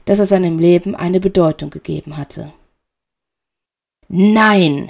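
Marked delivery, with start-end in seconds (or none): 4.1-4.9 loud cry of no; furious shout. A narrator's reading. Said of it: "schrie Severus".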